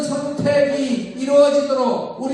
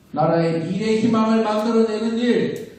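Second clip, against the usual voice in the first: ordinary talk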